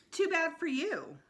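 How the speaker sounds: high, smug taunt. A narrator's reading. Sheila refusing to leave the rocks, smug and high. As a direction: tease them from up high.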